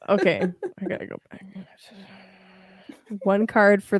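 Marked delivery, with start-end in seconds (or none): 0.8-1.4 whispered aside to herself; muttering to herself about getting back to the reading. Real, not acted. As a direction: breathe it, barely voiced. Aside to herself after game.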